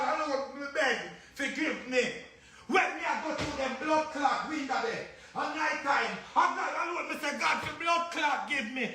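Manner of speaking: high-pitched furious rant with shouted curses; raging, pleading tirade; angry ranting prayer. Real, not acted. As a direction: rail at God and the listeners at the top of the voice.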